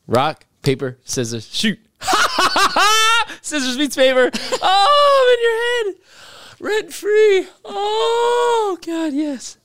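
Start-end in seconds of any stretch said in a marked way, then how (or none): 2.0-3.4 triumphant laugh. Real, not acted. Wins rock paper scissors. 3.4-9.6 triumphant shouting. Real, not acted. Celebrates winning first pick.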